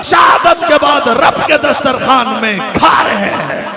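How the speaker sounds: full-force preaching with rhythmic repetition; fiery sermon. Acted. (जोशीला प्रवचन)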